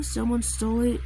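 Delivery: ordinary delivery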